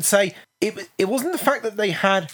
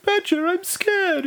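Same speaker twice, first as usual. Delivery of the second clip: high squeaky falsetto, slow; falsetto Mole voice; 13 semitones higher, slower than usual. Retold or imitated. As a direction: squeak it out like a scared little mole. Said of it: "Mole's voice is basically this".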